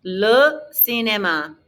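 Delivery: LE CINÉMA slowly, for repeating; teacher modelling LE CINÉMA, word by word; LE, then CINÉMA, carefully spaced; slow model pronunciation. Shown as a demonstration. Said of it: "Répétez".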